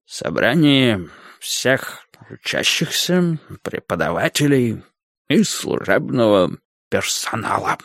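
slow, halting, tipsy secretiveness; mysterious. A narrator's reading. Tipsy cook announcing the meeting, mysteriously. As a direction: draw out each word, pausing as if drunk. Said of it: "также загадочно сказал повар".

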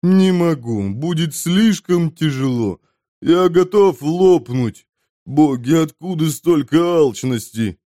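high, heavy complaint; lamenting glutton. A narrator's reading. Glutton refuses the journey, high complaining voice. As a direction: moan about the burden, stretching words.

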